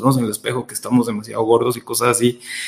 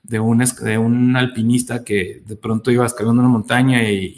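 normal talking voice

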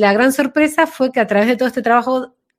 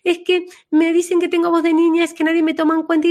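much higher, fast whiny complaint; little girl voice; 8 semitones higher, faster than usual. Retold or imitated. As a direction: imitate her childish plea to be heard. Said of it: "vino así con una vocecita".

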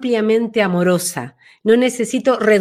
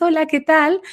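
high, disconnected little voice; high thin voice; 7 semitones higher than usual. Shown as a demonstration. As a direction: squeak out a tiny high greeting. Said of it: "tiene las vocecitas justamente muy altas, muy agudas".

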